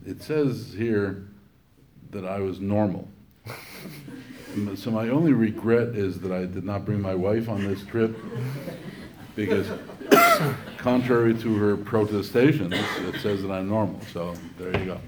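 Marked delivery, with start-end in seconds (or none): 3.4-4.5 light laugh. Real, not acted. Laughs at normal result. 4.5-15.0 laughing. Real, not acted. Joking about wife.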